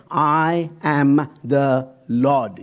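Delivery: I am the Lord drawn out very slowly; each word given full weight; solemn, very slow proclamation; slow emphatic. Shown as a demonstration. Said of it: "here's again".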